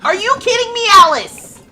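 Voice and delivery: high, loud disbelieving outburst; exasperated yell. Real, not acted. Player yelling at her Sim Alice, exasperated shout. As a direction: yell at the Sim who won't behave.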